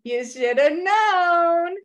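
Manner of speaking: smug resignation. Retold or imitated. Stuck couples lecturing newcomer.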